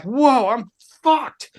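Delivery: slow, high whoa I'm fucked; drawn-out dismay. Retold or imitated. Drunk roadie facing his show, slow mock dismay.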